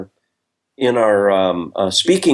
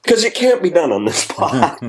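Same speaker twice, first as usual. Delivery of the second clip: ordinary talk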